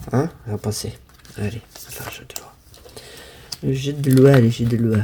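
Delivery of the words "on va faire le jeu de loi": whispered, quick and soft; a quick aside whispered under the breath. Real, not acted. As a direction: hush it to yourself, quickly. Says it, then whispers it.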